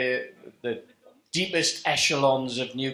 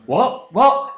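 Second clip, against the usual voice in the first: building, louder, higher "What? What?"; each "What?" jumps higher and louder; mock surprise; 8 semitones higher, 10 dB louder than usual. George baffled at being told not to touch wedges, rising mock surprise. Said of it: "And George is going".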